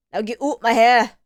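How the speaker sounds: put-on loud, clumsy Irish accent; bad Irish accent. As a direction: belt it out in a badly faked Irish brogue. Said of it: "does he have an Irish accent".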